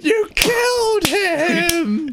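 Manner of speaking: high, slow drawn-out wail; outraged wail. Acted. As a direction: wail it in horror. Dubbed outraged cry.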